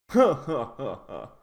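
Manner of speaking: a lightly voiced snooty villain laugh; haughty mock laugh. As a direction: give a smug little villain cackle. Haughty villain laugh after impression.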